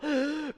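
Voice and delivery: a quick gasp for breath, shown; demo gasp. Shown as a demonstration. Instructor showing the class what too breathless sounds like, a gasp. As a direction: mime running out of air with a gasp. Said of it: "really out of breath".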